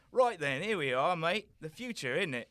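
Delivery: very loud, fast cockney; cockney Doctor. Acted. The Doctor offering to help the prince, in loud cockney. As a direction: boast in brash cockney.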